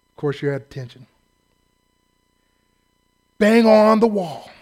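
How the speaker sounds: usual speaking voice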